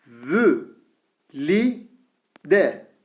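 Vü said with thin Ü, each syllable drawn out; syllable drill with thin vowel. Shown as a demonstration. Teacher reads the thin-vowel syllables to students, slowly. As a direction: read out each syllable apart. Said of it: "Vav harfi ince harf".

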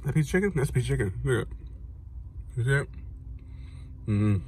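talking while chewing; chewing food. Real, not acted. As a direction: mouth partly full. Seen 1: Lips closed, jaw working after the bite at -9, holding the pastry.

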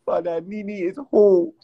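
a hint of an African accent, proclaiming; mock African accent. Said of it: "Taylor Craven said".

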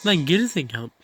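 furious yell at a bad driver; angry shout. Real, not acted. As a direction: snap it out loud and angry. Insulting another driver.

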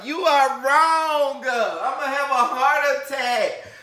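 very high, loud disbelieving cry; shocked delight. Real, not acted. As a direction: shriek in amazed disbelief. Shocked by the orchid.

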